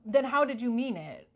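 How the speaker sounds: loud, challenging retort; sharp retort. Pretend. To a fake apologizer, a loud challenging retort. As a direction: demand an answer, pushing back hard.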